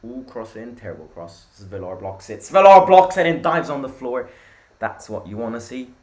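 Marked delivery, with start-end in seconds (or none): 2.4-4.3 louder, higher burst of play-by-play; excited commentary. Real, not acted. Football streamer reacting to a keeper's save, excited. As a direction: call the save with a sudden surge.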